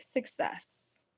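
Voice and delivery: hushed, gloating little victory; whispered triumph. Retold or imitated. Glitter fan after covering the whole house, whispered triumph. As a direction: whisper it like a secret win.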